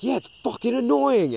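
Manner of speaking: high, loud, drawn-out irritation; fed up, join in the scolding; annoyed agreement. Retold or imitated. Backing up a friend against the tapping, annoyed and loud.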